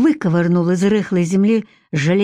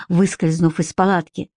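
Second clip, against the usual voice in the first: ordinary delivery, nothing marked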